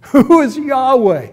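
high, loud defiant ruler's question; Pharaoh's challenge. Acted. Pharaoh questions why he should obey Yahweh, defiant. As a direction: throw it out loudly as a scornful challenge. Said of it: "Pharaoh has said to you".

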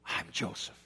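hushed breathy whisper of "I'm Joseph"; whispered reveal. Acted. Joseph revealing himself to brothers, whispered. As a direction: let it barely come out.

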